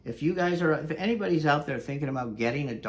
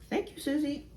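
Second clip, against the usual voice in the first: high, soft little dog voice; high squeaky pet voice; dog's voice; 12 semitones higher, 4 dB quieter than usual. Pretend. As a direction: thank her as the tiny dog.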